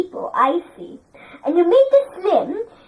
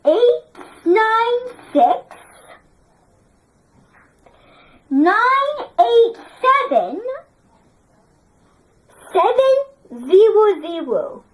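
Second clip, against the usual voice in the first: slow model reading. Shown as a demonstration. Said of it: "teacher models number reading".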